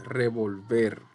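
revolver stressed on the final "ver"; stress on last syllable. Shown as a demonstration. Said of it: "Ver es la sílaba tónica".

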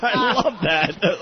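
usual speaking voice

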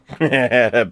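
short dismissive chuckle; brief scoffing laugh; scornful laugh. Real, not acted. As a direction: laugh off the rival as no threat. Scoffing at Argentina as a threat.

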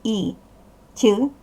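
ordinary talk, nothing marked

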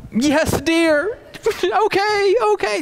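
hammed-up, very high eager-to-please husband; exaggerated lighter tone. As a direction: jump up and smile as you squeak it, mock sweet. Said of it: "say okay with a lighter tone".

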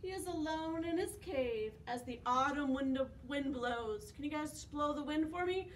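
ordinary delivery

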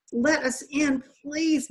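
high, pleading call to be let in; begging. Acted. Boy in the water begs the boat people, high pleading. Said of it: "Mahmoud begged".

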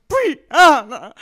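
loud mock wail of a name; retold scream-crying. Friend calling for her lost friend, mock scream-crying. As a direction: cry the name out, hammed-up. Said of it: "I started scream crying your name".

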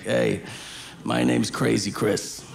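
lightly voiced low, steady menace; low-pitched mock convict threat; menacing prisoner. Crazy prisoner introduces himself to scared kids, low mock menace.

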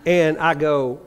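ordinary delivery

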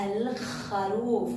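"الخروف" slow, with the ل held; pronounced lam. Shown as a demonstration. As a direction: stretch الخروف, L clearly before a scraped kh. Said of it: "من حروف اللام القمرية".

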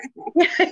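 sudden high burst of laughter; a real laugh that jumps up high; laughing in surprise. Real, not acted. Laugh breaks into her answer.

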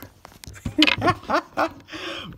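high giddy laugh; exhausted happy laughter; real laugh. Real, not acted.

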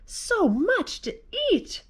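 high, delighted exclamation; excited mouse. A narrator's reading. Mouse thrilled at the leftover feast, excited high exclamation.

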